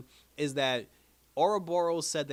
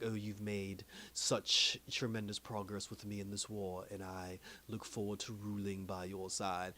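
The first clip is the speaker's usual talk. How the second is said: lower, slow and softer menacing voice; villain Kang voice; 4 semitones lower, 7 dB quieter than usual. Retold or imitated. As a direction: purr it like a scheming conqueror. Voicing Kang praising Renslayer.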